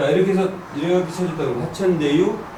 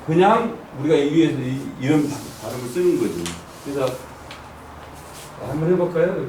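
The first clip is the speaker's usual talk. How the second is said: usual speaking voice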